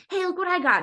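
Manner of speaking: put-on little kid friend. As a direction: play the eager little friend showing a toy. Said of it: "is like".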